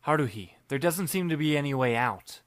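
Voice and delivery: ordinary delivery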